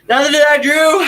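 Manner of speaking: big emphatic reply to the viewer; loud agreement. Real, not acted. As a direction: shout it back with feeling. Answering a fan's comment.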